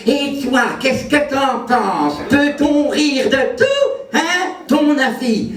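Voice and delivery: high, slow, mocking busybody voice; drawn-out caricature of a nagging questioner; pestering questioner. Someone pesters comic for an opinion, high mocking drawl. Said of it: "toujours quelqu'un pour venir te dire".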